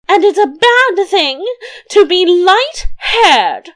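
high, loud screech of a queen; acted scream. A narrator's reading. Roused queen calls after departing king, screaming. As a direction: shriek the last word drawn out. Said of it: "screamed she".

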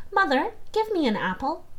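a small daughter asking sweetly for an apple; little girl asking. Acted.

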